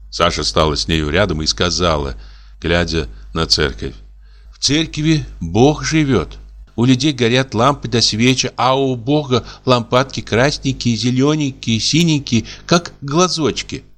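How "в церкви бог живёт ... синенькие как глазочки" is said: a girl lightly voicing her mother, higher; sing-song motherly storytelling, a little higher; imitating her mother. A narrator's reading. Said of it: "подражая своей матери".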